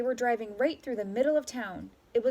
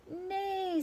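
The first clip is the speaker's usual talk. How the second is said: high, softer whinny; neigh; 9 semitones higher, 4 dB quieter than usual. A narrator's reading. Horse answers with a high neigh. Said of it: "said Horse".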